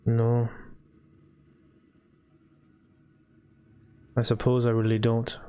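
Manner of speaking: reluctant character weighing it up, long pauses; lightly voiced hesitant homeowner. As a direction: think it over haltingly, lightly in character.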